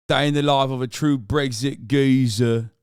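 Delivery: mock British.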